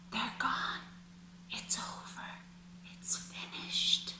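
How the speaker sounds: breathy whispered release, soft and slow; relieved whisper. Pretend. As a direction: let it out on a long exhale, barely audible. Imagined relief after letting go.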